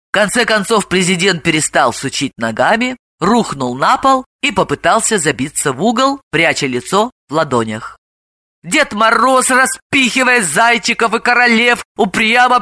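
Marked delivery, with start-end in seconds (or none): none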